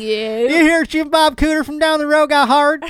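hammed-up Southern accent, high and loud. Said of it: "Southern Talk with Josh and Sarah".

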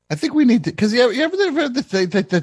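high, laughing tumble of words; high giddy stammer; excited fumbling. Real, not acted. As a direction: get carried away, giggling.

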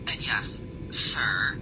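usual speaking voice

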